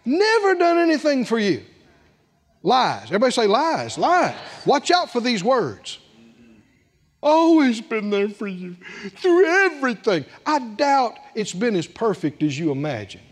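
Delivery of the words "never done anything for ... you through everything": a hint of a sulky, aggrieved son; complaining older son. Preacher voicing the older son's complaint, mildly whiny. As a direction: whine out the grievance, wronged. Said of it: "Do you hear the evil voice of unbelief?".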